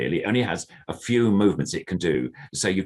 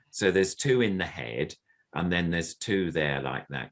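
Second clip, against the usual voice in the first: normal talking voice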